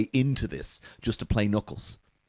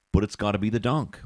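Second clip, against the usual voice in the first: ordinary talk, nothing marked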